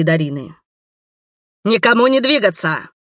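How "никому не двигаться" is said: loud, high commanding shout; booming command. Acted. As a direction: bark the order at the room. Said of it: "раздалось громкогласное".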